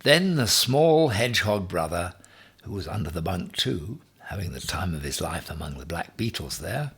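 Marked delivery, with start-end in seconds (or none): none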